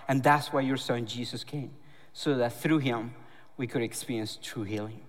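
closing prayer over the church. Acted. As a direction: pray quietly and reverently. Pastor leads a closing prayer.

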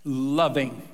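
Loving set apart, slow and falling; stressed loving. Shown as a demonstration. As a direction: land hard on Loving alone. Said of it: "loving and leading people to Christ".

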